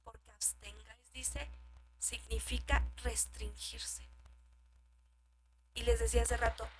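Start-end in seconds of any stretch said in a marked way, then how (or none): none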